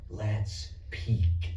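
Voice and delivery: low, sly little imp whispering temptation; impish whisper. Acted. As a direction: tempt him like a tiny imp in his ear. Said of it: "a tiny impish voice will whisper in your ear".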